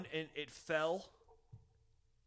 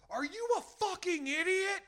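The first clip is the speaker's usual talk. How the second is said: high, drawn-out outraged yell; 6 dB louder, slower than usual. Retold or imitated. Half-asleep man to noisy upstairs neighbour, furious retold yell. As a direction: scream it at the neighbour overhead. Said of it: "just yelling".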